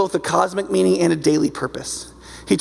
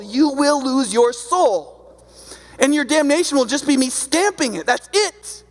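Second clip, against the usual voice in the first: God's stern warning voice, pitched higher; voicing God, grave and urgent; 4 semitones higher than usual. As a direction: speak as God warning of damnation, heavier on key phrases. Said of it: "It's just like".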